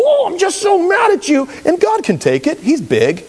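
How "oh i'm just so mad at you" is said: angry outburst at God. Pretend. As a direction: yell your frustration up at heaven. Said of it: "And you go".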